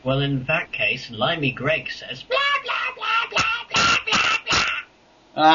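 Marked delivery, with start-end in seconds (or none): 2.2-5.5 very high squeaky blah-blah; squeaky mock voice. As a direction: squeak it out like a silly cartoon. Mocking a viewer's question as gibberish.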